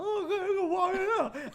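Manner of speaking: put-on high, eager little kid voice; naive little kid. Imitating his trusting child self.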